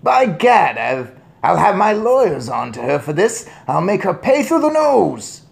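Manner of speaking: loud, high bluster; furious shout. A narrator's reading. Father threatens witch with lawyers, shouting. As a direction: threaten revenge, spluttering. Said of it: "shouted Mister Jenkins".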